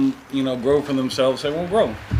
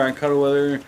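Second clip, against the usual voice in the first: ordinary talk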